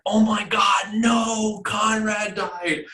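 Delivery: high, shouted mock dismay; dramatic shock. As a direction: ham up a shocked wail. Mock overdramatic reaction quote.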